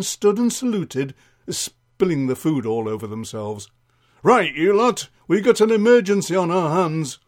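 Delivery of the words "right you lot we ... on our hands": loud, higher urgent announcement; rally the troops in a flap; flustered sergeant. Acted. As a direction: sergeant raising the alarm, voice up. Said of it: "Sergeant Snowdrop entered in a flap".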